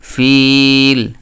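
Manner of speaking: ফিল with a long held ফ; drawn-out fa sound. Shown as a demonstration. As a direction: stretch ফিল out slowly. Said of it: "এইভাবে করে ফা উচ্চারিত". (টেনে টেনে ফিল)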